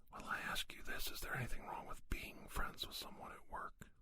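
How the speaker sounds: usual speaking voice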